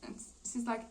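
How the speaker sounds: ordinary delivery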